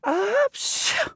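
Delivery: gasp, then sneeze hard; big sneeze. Acted. The peddler sneezing from pepper in his nose, gasp then burst.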